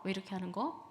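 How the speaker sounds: ordinary delivery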